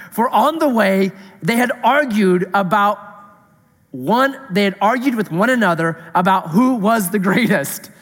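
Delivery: measured, solemn Bible reading; reading the Gospel to the congregation; scripture reading. Acted. Pastor reads the Gospel passage aloud, measured and solemn.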